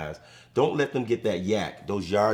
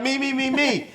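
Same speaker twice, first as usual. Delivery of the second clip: much higher, urgent repeated me; frantic retold call; 13 semitones higher than usual. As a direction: rookie nervously taking the ball himself. Rookie retold accepting the kick.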